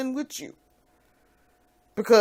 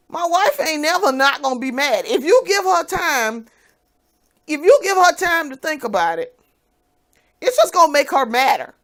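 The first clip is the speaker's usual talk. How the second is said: higher, louder scolding shout; furious tirade; 6 semitones higher, 4 dB louder than usual. Retold or imitated. Yelling at ex-husband who unblocked her, furious. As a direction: tear into him, full volume. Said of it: "And I'm like".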